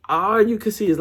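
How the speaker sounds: usual speaking voice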